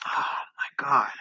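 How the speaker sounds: breathless whispered disbelief; shocked whisper. Real, not acted. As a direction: whisper it, stunned and slow. Announcing Stan Lee's death.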